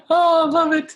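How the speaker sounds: high, laughing through the words; laughing delight. Real, not acted. Cheering on the co-host's story, laughing high. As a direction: cackle with glee at the comeback.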